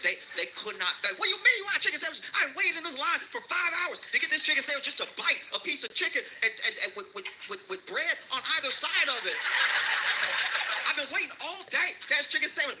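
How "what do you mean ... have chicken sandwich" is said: high, fast, laughing mock rant; frantic customer. Comic mimics a frantic chicken sandwich customer, high mock rant. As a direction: squeal it like a desperate sandwich fan.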